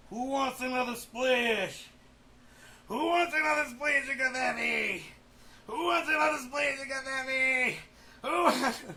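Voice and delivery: high loud hammed-up call; mock carnival call. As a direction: bellow it like a game-show host.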